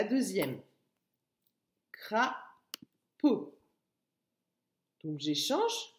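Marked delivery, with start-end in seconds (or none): 1.9-3.5 "Crapaud" pulled apart by a long pause; two separate beats on "Crapaud"; split into syllables. Shown as a demonstration. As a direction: say "Crapaud" one syllable at a time. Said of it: "c'est la première".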